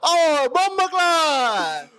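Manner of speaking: high, drawn-out hammed-up Jamaican accent. Shown as a demonstration. Friend running with his Jamaican slip, hammed-up Jamaican accent. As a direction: stretch every phrase into exaggerated Jamaican patois. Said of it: "You almost went Jamaican there".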